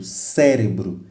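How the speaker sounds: "cérebro" with the force on CÉ; stress on first syllable. Shown as a demonstration. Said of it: "a sílaba tônica é C".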